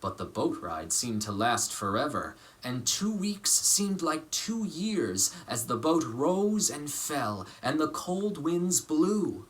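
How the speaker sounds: lively picture-book reading voice; story narration. A narrator's reading. Actor reading a picture book to children, lively storytelling. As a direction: read it warmly to children.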